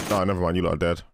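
ordinary talk

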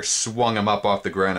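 plain reading voice

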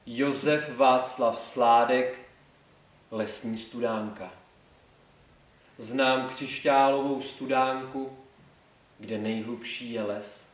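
slow, measured classical poetry reading; solemn school-recital delivery, dropping at line ends; poem recitation. Shown as a demonstration. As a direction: recite it slowly, with pauses between phrases.

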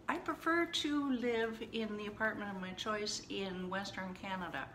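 prim, upbeat correction; better-sounding rephrase. Shown as a demonstration. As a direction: put it nicely, with exaggerated care. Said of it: "does that sound a little bit better".

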